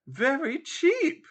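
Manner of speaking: high, slow, smiling condescension; pitying smile. Acted. Landlady corrects the ignorant lodger, high and slow. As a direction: pat her on the head with it. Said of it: "benign smile of pity".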